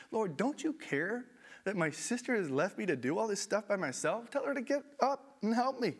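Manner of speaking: high, pleading whine of an overworked sister; begging Jesus for help, voice climbing; Martha complaining. Acted.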